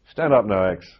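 loud parade-ground command; barked order. Retold or imitated. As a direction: order him up like a sergeant major. Sergeant major barking an order.

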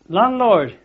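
calling out loud and high to the landlord; old chap in the corner shouts across for the landlord, loud call; retold shout across the pub. Old chap hails the landlord across the room, loud shout. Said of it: "shouts across".

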